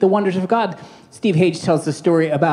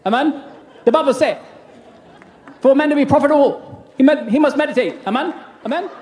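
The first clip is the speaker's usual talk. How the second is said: Joseph Prince impression; 6 semitones higher than usual. Pastor mocks a famous preacher for the congregation, hammed-up preacher voice. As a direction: ham up a preacher's proclamation. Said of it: "you don't have to sound like Joseph Prince".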